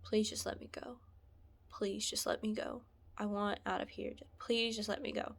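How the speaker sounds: quiet, weary plea; understated, subdued begging; retold begging. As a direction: plead softly, nearly under the breath. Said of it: "I just begged him".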